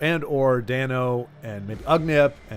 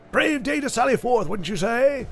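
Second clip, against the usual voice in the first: much higher, hammed-up British accent; 10 semitones higher than usual. Streamer mocking the Western game's dialogue, hammed-up British accent. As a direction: overplay a posh British adventurer. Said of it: "you must speak with a British accent".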